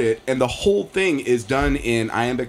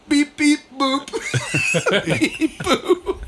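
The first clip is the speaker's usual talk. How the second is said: high, far-off robot beeping, with laughter; beeping like R2-D2 while explaining the Star Wars Shakespeare play; R2-D2 beeps; 13 semitones higher, slower than usual. Retold or imitated. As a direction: chirp like a little droid, breaking into laughs. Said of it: "R two D two has lines".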